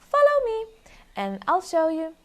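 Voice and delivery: very high, loud call; high mole voice. Acted. Mole leading the foxes home, very high and loud. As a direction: squeak it like a small mole. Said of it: "replied the mole".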